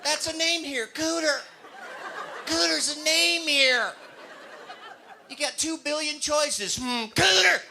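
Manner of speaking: high, loud incredulous mock Texan; mocking Cooter. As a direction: savor the name with disbelief.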